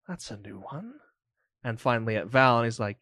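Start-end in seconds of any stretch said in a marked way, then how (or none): 0.1-1.0 much softer, knowing villain; quiet villain line. Acted. Robed man recognising Mick, much softer.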